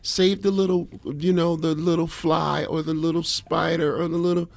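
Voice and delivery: gently mock someone pleading for tiny creatures; mock bug-saver voice. Host imitating people who save little bugs, high pleading mock voice. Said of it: "saying it in that voice".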